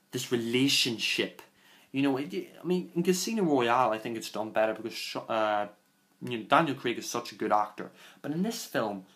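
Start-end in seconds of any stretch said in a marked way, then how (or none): none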